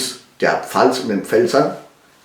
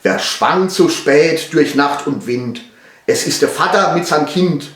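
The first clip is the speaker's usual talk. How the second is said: Palatinate dialect; 4 semitones higher than usual. Shown as a demonstration. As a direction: recite in broad Palatinate German. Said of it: "vom Erlkönig auf Pfälzisch". (Pfälzischer Dialekt, vorgetragen)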